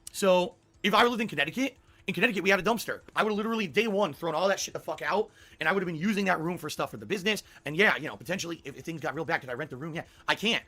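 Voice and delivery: normal talking voice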